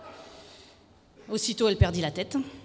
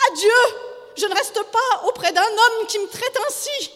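high, loud outraged wife; theatrical high-pitched indignation; indignant howl; 12 semitones higher, 10 dB louder than usual. A narrator's reading. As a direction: howl it as a mortal insult. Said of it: "elle s'indigna, hurlant".

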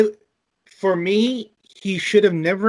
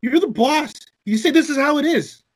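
retold insistence; 11 semitones higher, 3 dB louder than usual. Retelling employee's firm advice.

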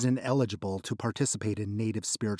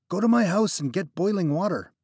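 higher, louder urgent demand; desperate demand; 9 semitones higher, 6 dB louder than usual. Acted. Trapped friend demanding boiling water. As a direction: order him off, desperate.

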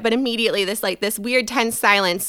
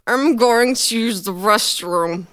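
talking through a mouthful; mouth full; 4 dB louder, slower than usual. Retold or imitated. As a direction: say it with cheeks stuffed. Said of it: "with fourteen tots in my mouth".

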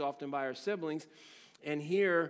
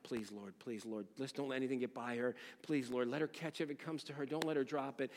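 much softer, lower pleading prayer; whispered prayer; 3 semitones lower, 6 dB quieter than usual. Retold or imitated. Coach dad praying for his daughter in left field, a hushed plea. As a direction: beg the Lord under your breath. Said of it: "I'm just praying while I'm there".

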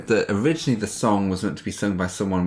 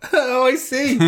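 laughing high oh; slower than usual. Real, not acted. Laughing at the rule.

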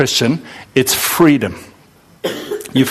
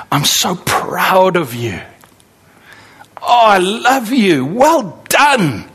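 proud parent; 3 semitones higher, much slower than usual. Retold or imitated. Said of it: "earthly mother or father saying".

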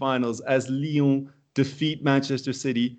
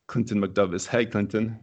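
ordinary delivery

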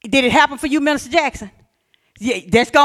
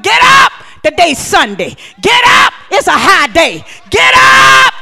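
shouted wake-up call; 6 semitones higher, 6 dB louder than usual. Acted. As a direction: yell GET UP at full force.

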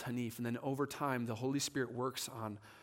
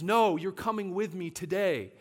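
louder, higher acted refusal; emphatic mock order, pitched up; insistent demand; 6 semitones higher, 7 dB louder than usual. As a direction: act out overruling a friend. Acting out the repeated insistence.

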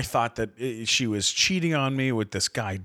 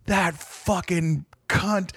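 slow, higher-pitched cursing; retold seething; 5 semitones higher, much slower than usual. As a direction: replay a slow-burning fury. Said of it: "fucking lose my mind".

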